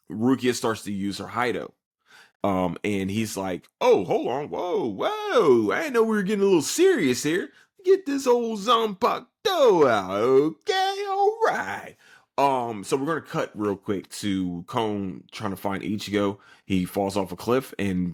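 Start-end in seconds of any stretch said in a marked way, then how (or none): 3.8-12.0 very high, squealing mock protest; hammed-up alarm. He reacts as Rukia readies her attack, hammed-up alarm. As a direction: stretch it out in a comically high voice. Acting out him facing drawn weapon.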